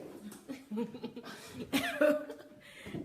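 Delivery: high breathy laugh. Real, not acted. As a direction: laugh at your own fumble. Amused at mispronouncing a name.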